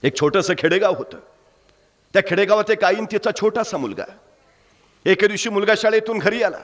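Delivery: emotive Marathi storytelling, rising with the drama; story narration. A narrator's reading. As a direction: tell it like a village storyteller moving the crowd. Storyteller narrating village tale.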